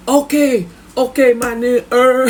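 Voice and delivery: high, loud, accented mimicry; imitating the cellmate. Cellmate greeting the neighbor wrongly, high mimicking voice. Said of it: "So he be telling Capone".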